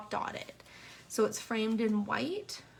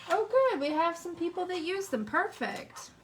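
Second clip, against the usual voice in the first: bright burst of delight, higher and louder; pleased surprise at the replies; excited; 7 semitones higher, 4 dB louder than usual. Real, not acted. Viewers answer her question.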